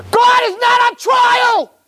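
high, loud, slow preacher shout; fiery preaching. Real, not acted. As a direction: thunder it at the congregation. Preacher's climax declaration.

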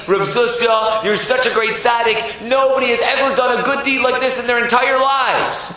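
high, loud, gushing praise; lavish flattery. Acted. Yetzer Hara clapping Zusha on the back, gushing. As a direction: build the praise to extravagant heights.

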